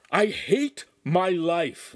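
shaking with rage. A narrator's reading. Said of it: "still shaking with rage".

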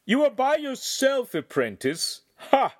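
high, loud taunt with a scoffing ha; light mocking shout. A narrator's reading. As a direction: taunt him across the clearing. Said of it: "he shouted, his voice quite light".

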